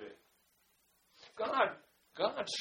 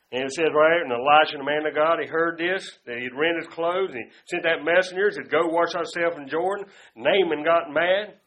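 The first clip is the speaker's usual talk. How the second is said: loud, driving preacher cadence; fiery preaching; 4 semitones lower, 10 dB louder than usual. Acted. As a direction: preach it hard to the congregation. Preaching at full force.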